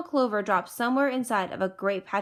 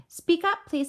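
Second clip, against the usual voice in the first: higher, slightly raised call; speaking up; 7 semitones higher, 3 dB louder than usual. A narrator's reading. Horton asks the faint voice to speak up, calling. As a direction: call out politely to someone faint. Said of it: "said Horton".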